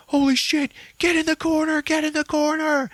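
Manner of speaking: high, loud panicked command; panicked order. Retold or imitated. James telling Lisa to hide, high panicked shout. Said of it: "James is just like".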